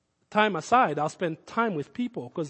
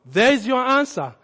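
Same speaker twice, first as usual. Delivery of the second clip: usual speaking voice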